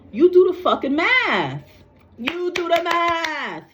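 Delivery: high-pitched exasperated outburst, stretched at the end; really angry. Real, not acted. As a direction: throw it out in angry exasperation, dragging the last words. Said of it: "I'm actually really getting angry".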